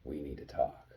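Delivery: a touch of parental sternness, said low; mildly stern mom. Retold or imitated. Mom warning her son he's in trouble, mildly stern. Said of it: "with that stern".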